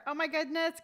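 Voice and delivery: usual speaking voice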